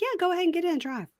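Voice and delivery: high, fast, careless mock voice. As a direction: toss it off breezily. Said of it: "why is she just like".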